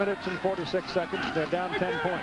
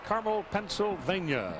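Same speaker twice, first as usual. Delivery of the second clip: excited play-by-play; 6 semitones higher than usual. Real, not acted. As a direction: call the big play as it unfolds, lifting the pitch. Calls a long completed pass.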